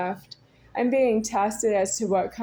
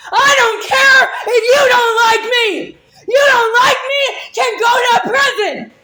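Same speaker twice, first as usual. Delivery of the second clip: much higher, louder, crying-laughing scream; sobbing laughing scream; 10 semitones higher, 9 dB louder than usual. Real, not acted. Screaming at the accuser through tears and laughter. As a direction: break into a tearful, laughing scream.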